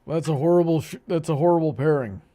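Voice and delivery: usual speaking voice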